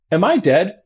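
light high casual question; patient asking mildly. Retold or imitated. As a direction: ask it like ordering onion rings. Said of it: "In that tone of voice".

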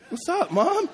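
very high, laughing, cheeky; a son grinning at mom; mock cheeky son. Pastor voicing the son greeting his mom, high and laughing. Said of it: "And it's like".

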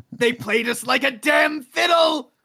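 loud, slow, hammed-up villain cry; mock dramatic shout. Friend hamming up a betrayal line for the podcast, slow mock shout. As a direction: belt it out like a movie villain.

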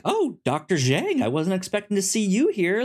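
high, quick surprised greeting; surprised guard. Retold or imitated. Guard greets Doctor Zhang in prison, surprised and high. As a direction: caught off guard seeing her here. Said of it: "he sees her and he's like".

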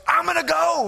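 high, loud eager volunteer. Retold or imitated. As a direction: jump in eagerly.

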